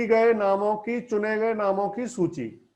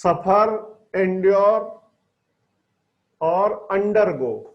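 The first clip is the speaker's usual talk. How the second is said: slow dictation; 4 dB louder, much slower than usual. Shown as a demonstration. Teacher dictating words slowly.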